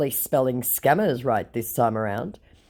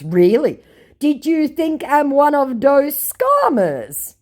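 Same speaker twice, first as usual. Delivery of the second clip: mock scammer voice; 12 semitones higher, 6 dB louder, slower than usual.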